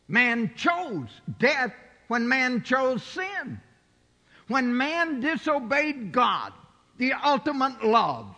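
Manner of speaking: high, loud, slow preacher's cry; preaching at full force. Acted. Preacher crying out to the congregation on sin and death, high and loud. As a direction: lift the church with a ringing, drawn-out call.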